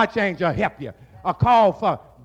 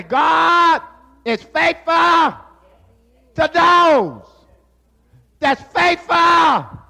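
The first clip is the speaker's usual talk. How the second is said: slow, higher, louder proclamation; preached repeat; 6 semitones higher, 5 dB louder, slower than usual. Shown as a demonstration. Preacher repeats the point to the congregation, slow shouted proclamation. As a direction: hammer it home, word by word. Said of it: "I say".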